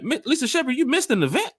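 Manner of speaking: high, disbelieving question; can't believe what she's hearing; surprised. Real, not acted. Shocked a fan missed event.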